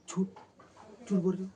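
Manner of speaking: loud, high accusing yell; angry shout. Retold or imitated. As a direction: yell 'liar' at him. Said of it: "ऐसे बोला". (ग़ुस्से में ऊँची चीख़, झूठ बोल रहे हो)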